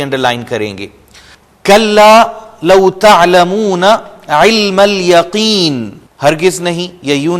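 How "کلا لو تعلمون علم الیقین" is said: slow, higher chanted recitation; Quranic recitation. Shown as a demonstration. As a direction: recite with tajweed, stretching each word. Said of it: "عین کو underline کریں گے".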